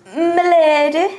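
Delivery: slow, loud, hammy courtier; bow and drawl it grandly; grand courtly address. Acted. Player addresses merchant formally.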